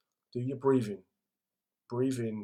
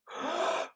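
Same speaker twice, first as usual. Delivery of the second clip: sharp demo inhale. Shown as a demonstration. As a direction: gasp in a big breath. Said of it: "On the sixth time".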